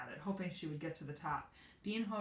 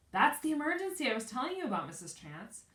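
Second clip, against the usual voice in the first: excited; 9 semitones higher, 8 dB louder than usual. A narrator's reading. Bean playing along loudly.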